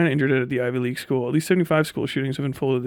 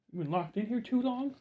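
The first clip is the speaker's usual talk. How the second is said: pet talk; 8 semitones higher, 10 dB quieter than usual. Real, not acted. Cooing at the dog.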